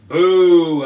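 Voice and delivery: loud boo; loud disappointed boo. Acted. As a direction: boo the plan like a bored kid. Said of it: "I said".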